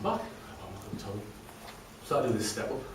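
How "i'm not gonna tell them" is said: under his breath; whispered to himself. Real, not acted. Deciding to hide his pain.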